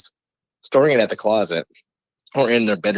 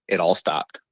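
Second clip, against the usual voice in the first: plain reading voice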